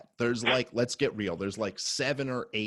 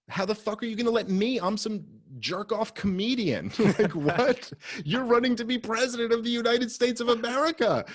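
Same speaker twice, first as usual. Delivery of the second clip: exasperated rant; 12 semitones higher than usual. Retold or imitated. Ranting at Gary Johnson, retold.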